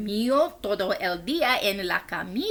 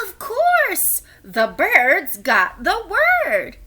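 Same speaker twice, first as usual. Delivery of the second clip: excited; 7 semitones higher, 4 dB louder, slower than usual. Acted. Exclaimed narration.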